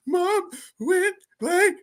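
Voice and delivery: put-on high, slow, crying child voice; crying little sister. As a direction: wail it like a hurt little girl. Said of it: "comes running in the room and she's crying".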